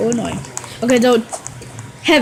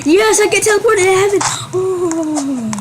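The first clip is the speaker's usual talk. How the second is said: high, fast squeal of triumph; excited cheer; 9 semitones higher, 3 dB louder than usual. Real, not acted. Player celebrating a teleport to heaven, excited cheer. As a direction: burst out loud and high, racing along.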